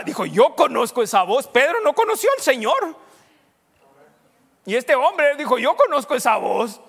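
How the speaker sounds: full-force sermon delivery; fiery preaching. Acted. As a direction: preach it out loud to the congregation.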